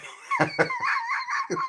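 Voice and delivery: high burst of laughter; amused laugh. Real, not acted. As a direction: break into a laugh. Laughs mid-sentence.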